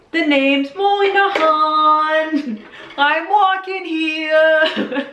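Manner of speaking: high, loud, very slow cartoon voice; Rick and Morty character. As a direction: drag it out like a drunk cartoon guy. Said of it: "become a Rick and Morty character".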